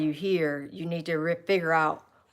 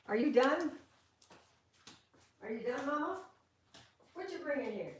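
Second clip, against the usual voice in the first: pet talk; 5 semitones higher, 9 dB quieter than usual. Real, not acted. Talking to her puppy at bedtime, high soft coo.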